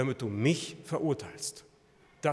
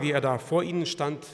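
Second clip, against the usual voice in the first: normal talking voice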